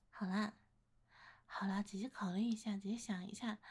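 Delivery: soft whisper. Acted. Big sister soothing shy admirer.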